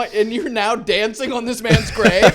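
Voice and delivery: high, loud mock outrage breaking into laughter; hammed-up scolding on the edge of laughing; outraged rebuke. Scolding a man over a grave, mock outraged. Same rebuke resumes after interjection.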